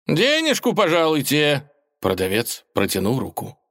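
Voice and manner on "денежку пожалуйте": high, put-on vendor voice; high-pitched hawker's demand; street seller asking payment. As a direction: ask for the money in a high market-trader voice.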